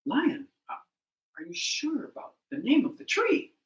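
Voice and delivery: politely doubting the king; doubtful animals. Acted. Doubtful animals question lion.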